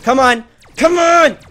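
high, loud gamer panic; frantic yelling. Real, not acted. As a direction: yell in real frustration at the game. Frantic in a tense game fight.

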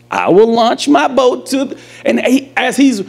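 usual speaking voice

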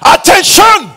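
high shouted order; drill commander. Acted. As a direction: call the troops to attention. Drills officer like an army commander.

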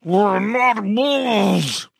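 high, very slow growled wail; werewolf howl. A narrator's reading. Werewolf insists to the crowd, howling slowly and high. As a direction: stretch the words into an animal howl.

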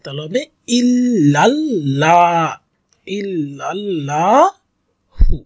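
ইল্লাল্লাহু read slowly with the joined sounds stretched; slow tajweed demo of ইল্লাল্লাহু; joined drawn reading. Shown as a demonstration. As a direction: draw ইল্লাল্লাহু out long and smooth, letters linked. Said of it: "যদি একসাথে টেনে পড়ি".

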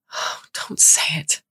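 slow breathless whisper; whispered plea. Acted. Cass dreading Maya's news.

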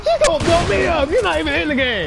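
outraged yelling. Real, not acted. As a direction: rant at the killer. Outraged at player killing all.

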